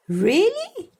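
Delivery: high incredulous question; can it really rain here; surprised doubt. Acted.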